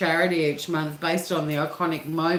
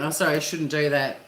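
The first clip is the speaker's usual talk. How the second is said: normal talking voice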